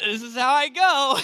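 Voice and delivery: resigned line through a laugh; laughing resignation. Retold or imitated. Speaker on the dropping plane, laughing resignation. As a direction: laugh it out, accepting your fate. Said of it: "I was like".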